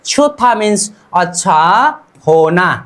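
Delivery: usual speaking voice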